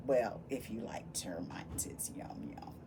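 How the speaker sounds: hushed whisper, like a secret joke; whispered aside. Acted. Teacher sharing a little joke with the kids, whispered. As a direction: lean in and whisper the punchline.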